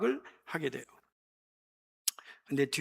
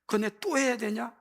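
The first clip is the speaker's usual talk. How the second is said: exasperated parent; 9 semitones higher, 4 dB louder than usual. Retold or imitated. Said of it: "나 가끔 애들한테도 그러잖아".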